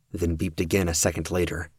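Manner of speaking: plain reading voice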